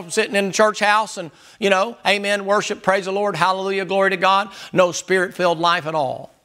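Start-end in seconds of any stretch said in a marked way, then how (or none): none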